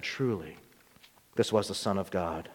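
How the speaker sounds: soft, low and reverent; hushed awe. A narrator's reading. Centurion at the cross realizing who Jesus was, hushed awe. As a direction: breathe it out in wonder. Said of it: "filled with awe and said".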